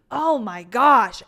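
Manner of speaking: loud, drawn-out exclamation; emphatic retold dismay; retold overwhelmed reaction. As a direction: stretch it out slowly and loudly. Retold reaction to tense scene.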